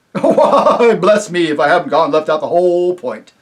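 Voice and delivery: high, loud, hearty rustic voice with a chuckle; jovial rustic chief, laughing as he talks; chief of the invisible folk. Acted. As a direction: boom it out like a jolly simple village chief.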